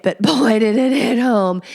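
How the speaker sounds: usual speaking voice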